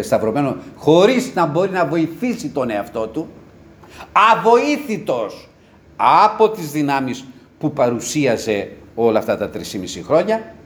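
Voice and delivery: normal talking voice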